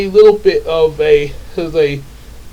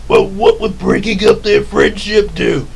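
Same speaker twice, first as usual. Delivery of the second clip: put-on questioning voice, raised pitch; Power Rangers henchman; 4 semitones higher than usual. Henchman asking the villain about the scheme, puzzled. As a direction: henchman doubting the plan. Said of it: "like the way Rita and Lord Zed".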